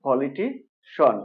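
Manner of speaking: "পলিটিশন" slowly, ending in a single syllable shon. Shown as a demonstration. Teacher showing the correct word, "পলিটিশন" ending in shon. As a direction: say "পলিটিশন" drawn out, poli-ti-shon. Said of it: "উচ্চারণ হবে".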